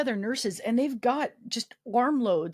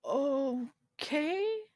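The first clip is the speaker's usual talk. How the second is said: hesitant, stretched okay rising high; baffled; 5 semitones higher, much slower than usual. Retold or imitated. Patient reacting as the nurse suddenly leaves, mock bafflement. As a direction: draw it out as a puzzled question.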